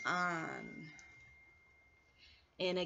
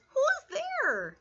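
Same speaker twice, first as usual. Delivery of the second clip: high squeaky mouse voice; a tiny timid mouse calling out; lightly voiced little mouse; 14 semitones higher than usual. Mouse voice in read-aloud story.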